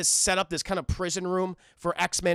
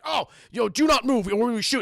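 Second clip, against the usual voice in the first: louder, urgent retold command; mock warning; 3 semitones higher, 4 dB louder than usual. Turret warns the warden to stay still, mock urgent.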